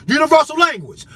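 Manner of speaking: shouted repeat. Real, not acted. Warning the government, shouting the repeat. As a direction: yell it out to the crowd.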